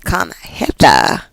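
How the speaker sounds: low, slow playful seduction; drawn-out, hammed-up come-on; mock dirty talk. Tarot reader playfully demonstrates talking dirty, low slow mock seduction. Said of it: "maybe you like to talk dirty".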